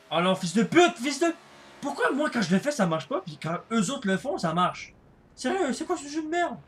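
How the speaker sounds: high, loud furious rant; raging gamer. Real, not acted. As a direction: yell in rage at the game. Raging at the game. (joueur en rage)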